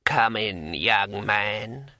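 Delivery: slow, drawn-out ancient voice; old, brittle and unhurried, like a long-dead ancient; creaking old man's welcome. A narrator's reading. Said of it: "said in a brittle old voice".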